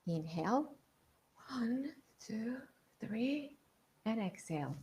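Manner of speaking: soft, hushed counting of breaths; breathing coach counting softly; guided breathing count. Shown as a demonstration. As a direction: lead the breath count gently. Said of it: "so here we go".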